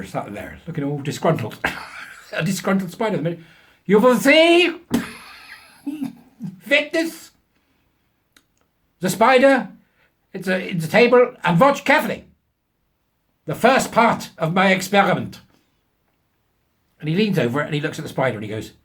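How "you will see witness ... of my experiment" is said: put-on much higher, louder, slow German accent; mock German professor. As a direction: ham up a self-important German scientist. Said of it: "of German extraction".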